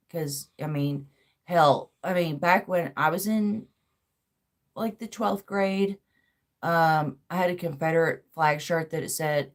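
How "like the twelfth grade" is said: normal talking voice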